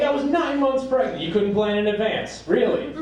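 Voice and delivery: high-pitched indignant wife; pissed-off nagging. Acted. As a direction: throw an old grievance at him. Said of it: "comes up whenever she's pissed".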